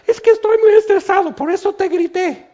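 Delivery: squeaky complaining voice, way up in pitch; whiny excuse. Retold or imitated. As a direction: play the stressed one making excuses, high and quick. Stressed person excusing yelling.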